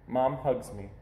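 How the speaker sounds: ordinary delivery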